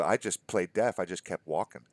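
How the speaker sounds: usual speaking voice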